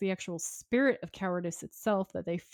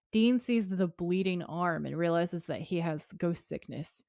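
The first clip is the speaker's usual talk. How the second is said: ordinary talk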